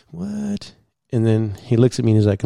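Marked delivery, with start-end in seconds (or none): 0.1-0.7 tiny high breathy meme what; meme kid what. Retold or imitated. Copying the little meme kid's high soft what. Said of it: "Like that meme with that little kid".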